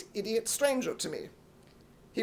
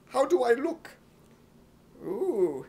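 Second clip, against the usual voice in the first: ordinary talk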